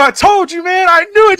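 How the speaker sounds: very high, loud crowing; gloating shout. Retold or imitated. Dad crowing to his daughter about the boy, high shout. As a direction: yell it like you've been proved right.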